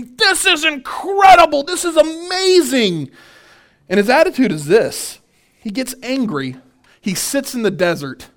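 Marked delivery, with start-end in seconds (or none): 0.0-3.1 much higher, slow, gushing wonder; mock delight. Expected Jonah marvelling at the city, high slow gushing. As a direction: drag out the awe like a thrilled prophet.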